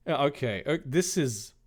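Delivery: usual speaking voice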